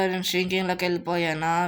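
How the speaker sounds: usual speaking voice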